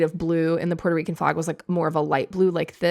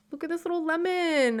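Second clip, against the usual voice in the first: cutesy voice; 9 semitones higher, 3 dB quieter than usual. Acted. Showing cute lemon plush.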